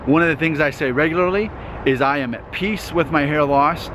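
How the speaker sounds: normal talking voice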